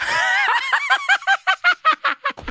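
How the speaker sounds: high shrieking laugh bursting out. Real, not acted. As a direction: let a big high laugh explode. Wife laughs about skeptic husband.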